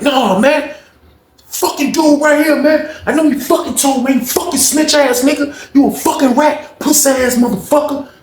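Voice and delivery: high, heated accusation; James raging. Retold or imitated. James cursing out Rob at his door, high heated voice. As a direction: spit it at the snitch.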